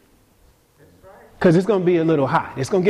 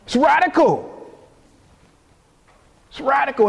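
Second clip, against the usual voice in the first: emphatic preaching; 9 semitones higher than usual. Acted.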